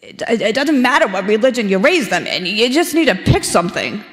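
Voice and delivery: an attempted old Brooklyn accent, with a laugh at the end. Father-in-law telling the couple to pick a religion, in a Brooklyn accent. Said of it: "I'm gonna try to do my best accent".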